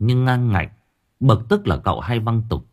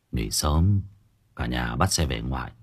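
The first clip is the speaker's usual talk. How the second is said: quiet, heavy-hearted father's words with a sigh; sad, sighing; 6 semitones lower, 6 dB quieter than usual. Acted. Father tells the child to come home, quiet and sad. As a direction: let it sink low and soft, weary with grief. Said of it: "Bố tôi nói giọng buồn buồn, thở dài".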